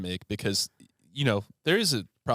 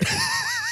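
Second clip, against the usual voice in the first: high laugh. Real, not acted. Laughing after the quote.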